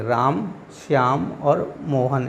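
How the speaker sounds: slow list, a short break after राम; राम, pause, Shyam और Mohan, drawn out; pauses between names. Shown as a demonstration. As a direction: linger on राम, stop briefly, then Shyam और Mohan. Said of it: "तो इसलिए क्या पढ़ते हैं इसको".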